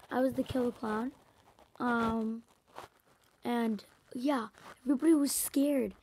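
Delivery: ordinary talk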